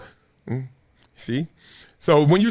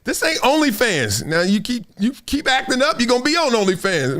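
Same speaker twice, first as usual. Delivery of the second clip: retold mock warning; 6 semitones higher than usual. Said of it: "I was like".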